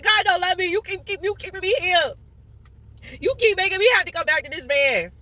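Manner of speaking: high whiny pleading; whiny complaint. Retold or imitated. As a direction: whine it high and hurt. Retold whiny complaint to God.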